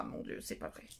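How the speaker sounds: whispered mock despair; whispering frustration at the names. Real, not acted. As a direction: hiss it to yourself, exasperated. Struggling with names.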